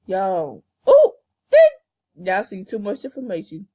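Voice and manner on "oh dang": sudden high loud yelp; loud surprise. Real, not acted. Girl startled mid boast, yelping loud.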